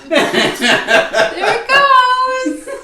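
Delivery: high laughing words. Real, not acted. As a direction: laugh through the line. Laughing at broken mood.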